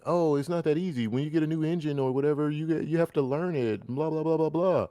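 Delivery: high, fast mocking whine; mocking developer excuse. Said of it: "they say when".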